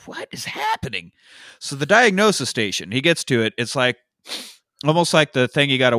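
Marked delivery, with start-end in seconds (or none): 0.0-1.1 high, baffled question; bewildered. Retold or imitated. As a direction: ask it in total confusion. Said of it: "I was like".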